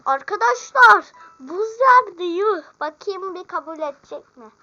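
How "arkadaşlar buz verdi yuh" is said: higher, louder thrilled announcement; excited shout. Real, not acted. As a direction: tell viewers, beside yourself. Announcing rare gift excitedly. (izleyicilere heyecanla bağırarak duyuru)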